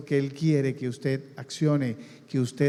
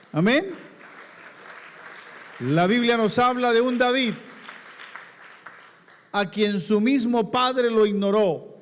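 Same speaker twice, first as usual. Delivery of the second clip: preaching at full force; 4 semitones higher than usual. Acted. Pastor to congregation about David, full preaching. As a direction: preach it out to the church.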